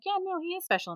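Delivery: high-pitched breezy agreement, imitated. Mother humouring a stranger about her son, breezy. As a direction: agree lightly, pitched well up.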